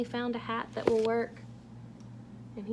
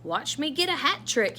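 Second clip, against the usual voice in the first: loud, higher cheer; shouted; 8 semitones higher, 7 dB louder than usual. Acted. Santa shouting to his dogs, loud and high. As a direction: call out proudly to the dogs. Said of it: "he shouted to Belle and Beau".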